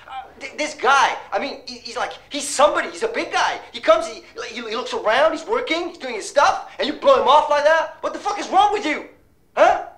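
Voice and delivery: can't believe his friend did this; incredulous rant. Acted. Friend ranting in disbelief.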